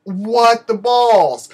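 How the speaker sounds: high, loud, drawn-out retold outburst; exasperated disbelief. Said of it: "and I'm like".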